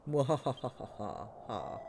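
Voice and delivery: slow, low evil cackle; evil villain laugh. Acted.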